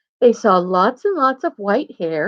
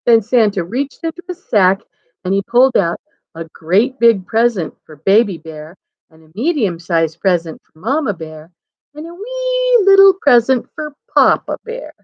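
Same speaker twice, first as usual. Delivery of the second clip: ordinary delivery, nothing marked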